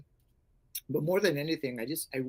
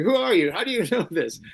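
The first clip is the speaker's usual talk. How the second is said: amazed; 6 semitones higher, 7 dB louder than usual. Retold or imitated.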